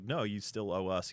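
usual speaking voice

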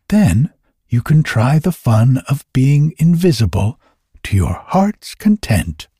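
kindly little elf. A narrator's reading. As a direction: grant a favor as a little elf.

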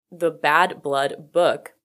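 slow, deliberate "the Bad Blood book"; careful self-correction. Real, not acted. Podcaster correcting a stumbled book title, slow and deliberate.

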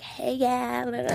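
imitating a comic doing a girl; girly voice. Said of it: "do the girl voice".

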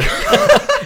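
real high laugh bursting out; high burst of laughter. Real, not acted. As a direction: crack up at the memory. Remembering the buffalo insult.

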